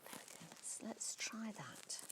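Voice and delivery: hushed whisper to herself. Real, not acted. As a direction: whisper while you work. Concentrating while fixing the nose.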